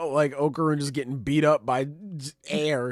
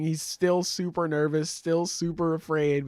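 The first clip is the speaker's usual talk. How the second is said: usual speaking voice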